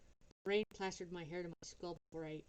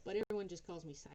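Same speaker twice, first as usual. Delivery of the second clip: ordinary delivery, nothing marked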